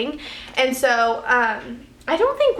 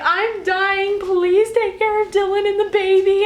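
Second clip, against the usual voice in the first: high, louder frantic plea; screaming in fear; 8 semitones higher, 3 dB louder, slower than usual. Retold or imitated. Mother in labor screams to her mom that she is dying. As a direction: wail it as if you're dying. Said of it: "Like, I'm screaming".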